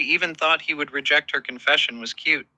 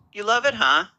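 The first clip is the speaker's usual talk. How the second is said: a hint of seductive teasing; lightly seductive, teasing; 6 semitones higher than usual. A narrator's reading. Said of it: "he repeated in a seductive voice".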